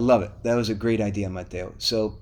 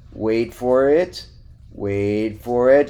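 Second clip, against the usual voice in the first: teasing suspense; much slower than usual. Real, not acted. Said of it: "teasing the next topic".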